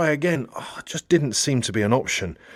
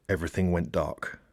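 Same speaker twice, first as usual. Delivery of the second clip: much softer and lower, fading out; hushed; 6 semitones lower, 8 dB quieter than usual. A narrator's reading. Narrator describing the blackout, hushed. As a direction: let it sink into darkness.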